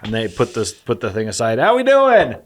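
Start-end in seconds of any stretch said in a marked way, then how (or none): 1.6-2.4 high, loud stage shout; rock singer shout. Retold or imitated. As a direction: hype the crowd like a frontman. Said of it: "the lead singer goes".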